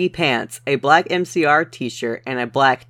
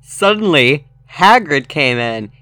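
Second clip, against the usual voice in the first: ordinary talk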